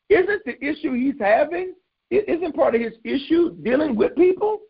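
very high-pitched mock bafflement; high, puzzled protest; incredulous questioning. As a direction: question it in a squeaky, disbelieving pitch. Voicing disbelief at God's instruction.

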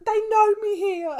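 high, loud laughing voice. Real, not acted. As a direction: say it through laughter. Laughing about fame.

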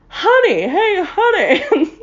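high, loud, drawn-out honey call; mock sultry husband call. Vlogger imitating the ad guy calling his wife. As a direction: croon it like a cheesy ad husband. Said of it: "That's not what the guy sounded like".

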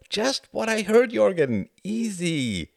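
high-pitched defensive backing off; placating. A narrator's reading. Man backing off before the angry pilot, placating.

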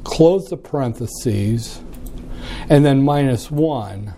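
slow, each digit spaced out; key in the numbers slowly, aloud; dictating. Shown as a demonstration. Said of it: "I have to use the calculator".

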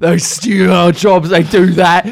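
loud, high, drawn-out rant; hammed-up bigot's complaint; imitating ranting lady. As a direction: belligerent caricature with slow, stretched words. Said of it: "And she's like".

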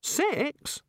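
high, eager voice of the god; high-pitched, fussy god voice. Acted. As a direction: play the chatty, enthusiastic creator god. The god asks.

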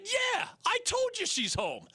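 put-on a small boy annoyed at being asked twice; impatient kid. Child impatient repeating answer.

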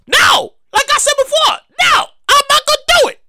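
high, emphatic mock protest; indignant refusal. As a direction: refuse point-blank, voice jumping up high. Acted refusal of a friend request.

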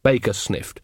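ordinary delivery, nothing marked

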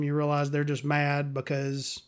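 normal talking voice

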